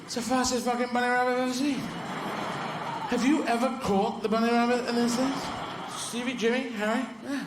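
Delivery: high, incredulous dog voice; high-pitched post-race griping; exasperated greyhound. Acted. Greyhounds complaining after the race.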